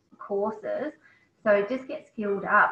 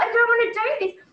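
retold tearful cry; 12 semitones higher, 8 dB louder than usual. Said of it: "I thought".

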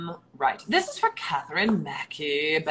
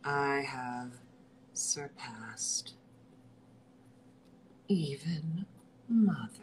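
whisper; 4 semitones lower, 6 dB quieter, slower than usual. Shown as a demonstration. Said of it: "whispered retake".

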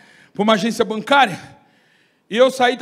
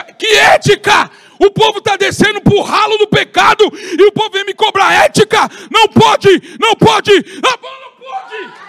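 much higher, louder indignant shouting; outraged shout; 9 semitones higher, 9 dB louder than usual. Acted. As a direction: scornfully throw the question back and shout it down. Pastor's outraged reply.

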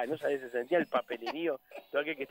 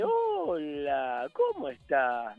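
slow, drawn-out greeting in a put-on voice; imitación del compañero; much slower than usual. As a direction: stretch each word like the colleague. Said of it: "habla así". (saludo arrastrado, imitando al compañero)

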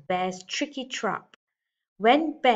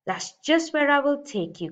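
higher and louder gruff bear threat; growling bear; 7 semitones higher, 4 dB louder than usual. Acted. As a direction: snap it at the goat like a grumpy bear. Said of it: "growled Bear".

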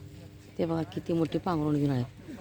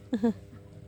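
short laugh; 5 semitones higher, 3 dB quieter than usual. Real, not acted. Laugh after teasing remark.

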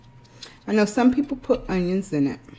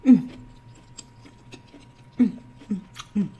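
talking with food in mouth; chewing. Real, not acted. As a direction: mouth partly full. Seen 1: Lips closed, chewing at start, then bites fried piece mid-utterance.

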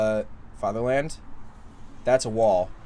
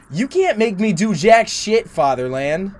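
defiant shout; 8 semitones higher, 6 dB louder than usual. Acted. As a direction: shout it back, defiant and high. Voicing defiant character line.

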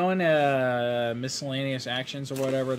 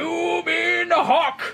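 high, slow wrestler bellow; Macho Man; 12 semitones higher, 4 dB louder, slower than usual. Acted. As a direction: blare it out like a wrestling star. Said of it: "I'll take this Macho Man".

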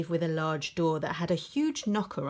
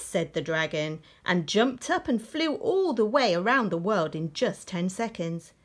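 usual speaking voice

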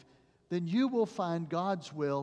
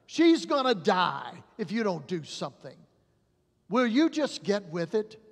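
loud, higher, furious demand; panicked father yelling for help; desperate father; 4 semitones higher, 7 dB louder than usual. Retold or imitated. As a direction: shout it at the doctor, grabbing him. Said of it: "the father grabbed the doctor and said".

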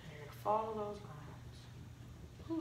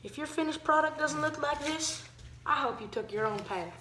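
loud, uplifting closing words; inspirational speech; 5 semitones higher, 6 dB louder than usual. Acted. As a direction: proclaim it like a sermon.

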